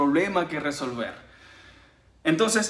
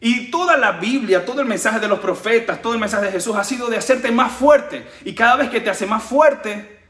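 higher and louder, preaching at full force; fervent preaching; 5 semitones higher, 4 dB louder than usual. Acted. Preacher rallying the congregation with full-force fervor. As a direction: lift the crowd with conviction.

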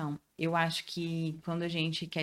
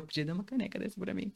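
playful hint; 5 dB quieter, faster than usual. Retold or imitated.

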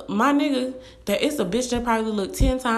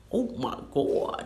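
whispered swoon; 2 semitones higher, 5 dB quieter than usual. Real, not acted. Swooning over ideal man.

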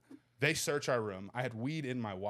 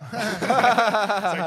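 bursting into laughter; laughing; 9 semitones higher, 14 dB louder than usual. Real, not acted. Friend laughing while telling the story, laughing. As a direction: laugh through the words.

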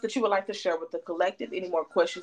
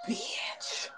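stunned whisper; 4 semitones higher, 11 dB quieter than usual. Real, not acted. Stunned reaction to reading.

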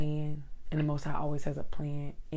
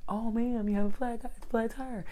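imitating a helpful stranger; 5 semitones higher than usual.

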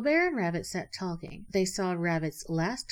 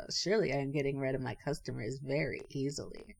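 low gloat after a little laugh; laughing to himself; 4 semitones lower, 4 dB quieter, faster than usual. A narrator's reading. Rabbit privately gloats over his vanishing customers, laughing to himself. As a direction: gloat quietly, mildly amused. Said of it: "laughed to himself and said".

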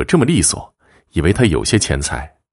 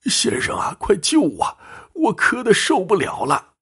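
put-on high, strained old man begging for help; desperate plea. Sick old man begging the fortune-teller for rescue, high and desperate. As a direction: plead in a thin voice, pitched way up.

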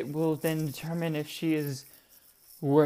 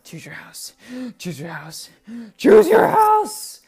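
pushy nagging crowd; 7 semitones higher, 11 dB louder, slower than usual. Pretend. Imagined fans pestering her over her house, rising to a shriek. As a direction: build from a hushed urge to a shrill squeal. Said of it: "people breathing down my necks like".

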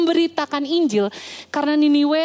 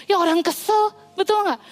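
usual speaking voice

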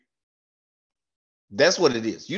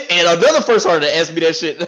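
usual speaking voice

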